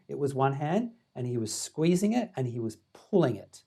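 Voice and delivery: usual speaking voice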